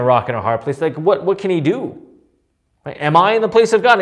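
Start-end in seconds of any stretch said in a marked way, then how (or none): 3.0-4.0 fast, higher, louder indignant question; angry retort. Acted. Jacob answering Rachel's demand, angry retort. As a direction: fire it back like a husband stung by blame. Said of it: "his anger, he was kindled".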